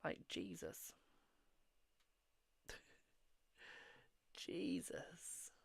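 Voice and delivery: breathy whispered amazement; whispered exclamation. Real, not acted. Streamer amazed at her photo's views, whispered. Reacting to view count.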